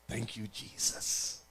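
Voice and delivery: whispered, low and slow; whispered aside. Retold or imitated. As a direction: breathe it as a secret thanks. Sly aside after mocking quote.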